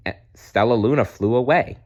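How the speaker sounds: ordinary delivery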